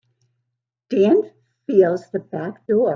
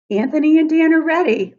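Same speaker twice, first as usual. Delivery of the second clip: higher, louder and quicker, eager; excited build-up; 9 semitones higher, 7 dB louder, faster than usual. A narrator's reading. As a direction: announce the team with rising excitement. Excited narration of rescue.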